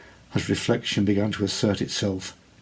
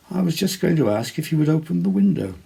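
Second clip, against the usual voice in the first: lady's voice; 8 semitones higher, 4 dB louder, faster than usual. A narrator's reading. Lady speaks to Theodoric.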